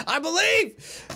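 high, loud rallying cry; hyped shout. Real, not acted. Streamer rallies himself before the pull, high cheer.

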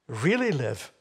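"really live" slow and emphatic. Shown as a demonstration. Preacher repeating what the verse emphasizes, emphatic. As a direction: lean firmly on "really", stretching out "live". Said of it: "He emphasizes it again".

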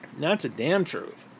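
ordinary delivery

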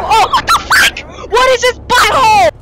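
very high frightened cry; scared shriek. Real, not acted. Scared by monster.

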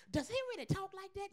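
mock hushed disbelief; hushed puzzled onlooker. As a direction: quietly imitate a puzzled onlooker. Audience's puzzled question, voiced.

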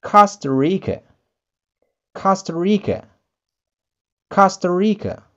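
Costa Rica said KAH-stuh REE-kuh; English Costa Rica. Shown as a demonstration. Teacher models Costa Rica for learners. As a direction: stress COS in Costa Rica. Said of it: "We may say".

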